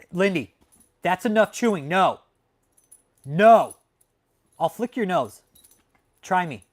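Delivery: sharp command, no nonsense; scolding dog. Real, not acted. Telling Lindy the dog to stop chewing, loud scold.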